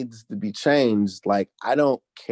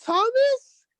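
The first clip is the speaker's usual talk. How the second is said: very high, drawn-out holler; high-pitched country cartoon call; 4 dB louder than usual.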